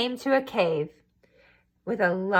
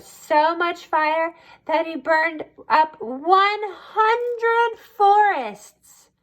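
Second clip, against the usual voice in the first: dramatic build; 7 semitones higher, 4 dB louder, much slower than usual. A narrator's reading. Dramatic narration of fire.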